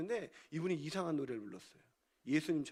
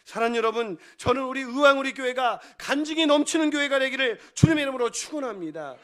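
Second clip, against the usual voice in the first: loud, ringing proclamation; preacher's blessing; 3 semitones higher, 10 dB louder than usual. Real, not acted. Preacher blesses own church in the Lord's name, loud fervent declaration. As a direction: proclaim the blessing over everyone.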